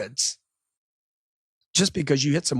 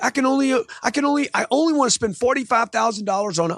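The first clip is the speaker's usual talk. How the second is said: mildly acted customer protest, pitched up; higher, stumbling customer complaint; upset customer; 7 semitones higher, faster than usual. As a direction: play a buyer objecting to the price. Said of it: "the guy tells you".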